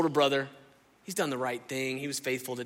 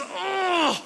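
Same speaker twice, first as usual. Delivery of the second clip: big hammed-up groan, pitched much higher and louder; loud disgusted groan; 4 dB louder than usual. Relative is coming for Thanksgiving, a loud mock groan of dread. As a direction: let out an over-the-top fed-up groan.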